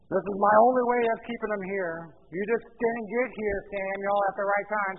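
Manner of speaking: loud, defensive self-justification; blaming excuses. Acted. Saul makes excuses to Samuel, defensively blaming. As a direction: push the blame onto someone else. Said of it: "He blames the people".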